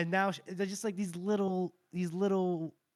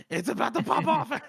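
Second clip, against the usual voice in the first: higher, louder and laughing; laughing excitement; 8 semitones higher, 7 dB louder than usual. Retold or imitated. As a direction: build it up to a giggle. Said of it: "You just go".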